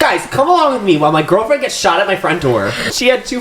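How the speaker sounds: high, chirpy influencer voice; mock a cheery vlogger intro. Mocks vlogger voice.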